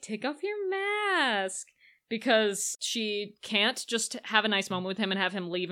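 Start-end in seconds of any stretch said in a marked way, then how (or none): 0.0-1.7 high, drawn-out plea; acting out her demand. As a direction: stretch it out high and softly, retelling her line. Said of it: "she's like".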